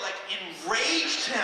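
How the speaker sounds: ordinary talk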